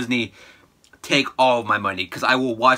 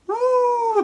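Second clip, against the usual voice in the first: very high woo; cheering woo. Real, not acted. Cheering after the remember line, a high woo. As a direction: whoop with glee.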